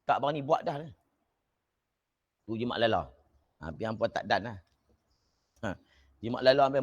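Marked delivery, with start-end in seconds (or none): none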